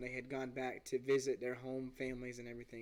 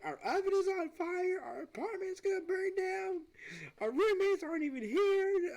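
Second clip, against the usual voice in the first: terrified scream; 3 dB louder than usual. Retold or imitated. Two roommates facing a burning oven, terrified screaming. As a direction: shriek it like the oven just exploded. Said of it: "just filled with fear".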